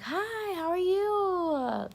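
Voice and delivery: high, drawn-out friendly hello; bubbly greeting. Retold or imitated. Flight attendant greeting a passenger, bubbly and high.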